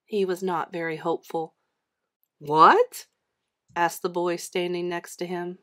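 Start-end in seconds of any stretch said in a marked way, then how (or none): 2.4-3.1 sharply rising, loud "what?"; a puzzled, louder query from a low start; surprised question. A narrator's reading. Boy in line questioning John's muttering, surprised.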